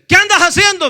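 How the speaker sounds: high, loud mock confrontation; angry demand. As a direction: act out the hostile man demanding answers. Hostile man confronts preacher again. (reclamo airado y fuerte, imitado)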